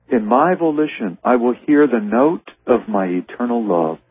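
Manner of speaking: lifted, unhurried reading of a holy text; slow prayerful reading over music; slow devotional reading. Acted. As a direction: draw out each phrase like a prayer. Reading Jesus' words slowly.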